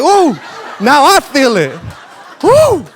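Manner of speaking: loud, high, laughing whoops; swept up too. Retold or imitated. Pastor caught up in the woman's fervor, whooping loudly. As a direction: ride the wave, shouting for joy. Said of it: "I started going like that too".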